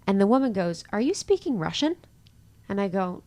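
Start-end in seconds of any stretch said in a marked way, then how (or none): 0.9-1.9 high, loud incredulous question; imitated astonishment. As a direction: mimic a baffled stranger asking. Voices the puzzled dog owner.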